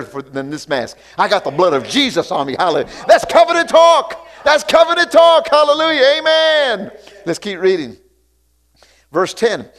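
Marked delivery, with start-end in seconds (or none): 3.1-6.9 high, loud fired-up refrain; shouting preacher. Real, not acted. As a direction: preach it at the top of your voice.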